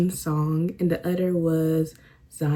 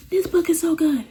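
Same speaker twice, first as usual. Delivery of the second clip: much higher and louder, thrilled; excited gushing; 11 semitones higher, 7 dB louder than usual. Real, not acted. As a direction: burst out in delight about the book. Gushing about favourite book.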